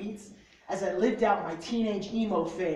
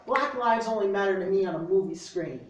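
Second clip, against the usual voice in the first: loud, punchy slam poetry delivery; spoken word poem; 3 semitones higher, 7 dB louder than usual. Acted. Reading his poem to friends in a bookstore, loud slam declamation. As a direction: declaim it like a slam poet calling out the crowd.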